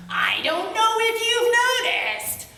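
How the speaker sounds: high, sheepish monster voice; a big creature owning up; giant's voice. A narrator's reading. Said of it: "the Chenu said".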